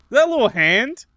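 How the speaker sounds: high loud shocked question. Real, not acted. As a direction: react in disbelief. Shocked reaction to game.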